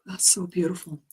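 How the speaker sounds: soft, breathless whisper of wonder; awed whisper. Real, not acted. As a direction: whisper it to yourself, moved. Moved by her own reading.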